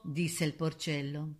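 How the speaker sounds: usual speaking voice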